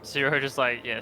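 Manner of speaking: normal talking voice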